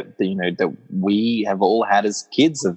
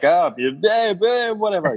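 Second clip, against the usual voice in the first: offhand brush-off, pitched up; dismissive; 9 semitones higher than usual. Retold or imitated. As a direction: wave it away like it's nothing. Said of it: "you can see him just kind of be like".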